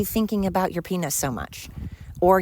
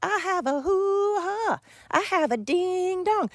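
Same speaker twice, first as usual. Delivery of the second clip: hammed-up squealing cheer, much higher and slow; mock celebration; 13 semitones higher than usual. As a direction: parody someone showing off as if it's a prize. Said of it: "We're like".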